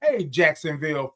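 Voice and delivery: ordinary talk, nothing marked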